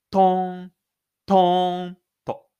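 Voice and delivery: loud drawn-out echo of a stamp; echoing stomp. A narrator's reading. Narrator voicing his stamp echoing down the hall, loud and drawn out. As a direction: ring it out like a stomp in an empty hall.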